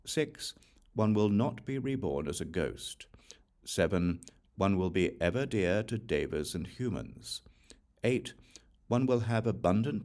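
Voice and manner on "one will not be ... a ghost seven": ordinary delivery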